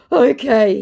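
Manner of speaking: high, loud mock okay; caught-out whine; imitating the scammer caving. Scammer caves in.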